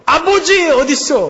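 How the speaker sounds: high, drawn-out call for Father; crying out. Acted. Preacher demonstrates calling to God the Father, crying out. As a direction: cry out as if searching desperately. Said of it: "라고 부르짖어야 되는 거예요".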